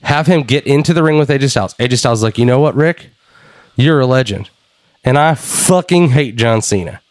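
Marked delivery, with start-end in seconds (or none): none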